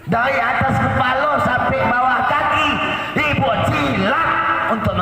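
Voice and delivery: high, emphatic sermon delivery. Real, not acted. Preacher recounting a second hadith to wives, high emphatic preaching. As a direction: press each item home, pitched high.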